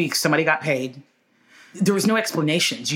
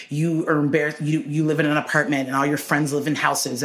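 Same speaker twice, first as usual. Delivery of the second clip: ordinary talk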